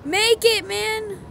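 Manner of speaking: will the shot to land; urgent shout. Real, not acted. Urging a tricky jump.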